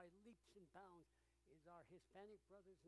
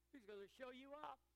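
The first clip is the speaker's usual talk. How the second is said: emphatic repeat; 5 semitones higher, 7 dB louder than usual. Acted. Repeated line, louder second take.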